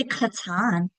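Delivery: KATAN as kuh-TAHN, the misread word with a full last vowel; wrongly stressed second syllable. Shown as a demonstration. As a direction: put the weight on TAN in KATAN. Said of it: "you need to talk about accenting".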